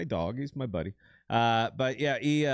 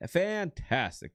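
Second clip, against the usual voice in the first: "Fantastic" stretched slow and sung out much higher; drawn-out emphatic fantastic; 8 semitones higher, much slower than usual. Real, not acted. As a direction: savor "Fantastic" slowly from high up. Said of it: "is fantastic".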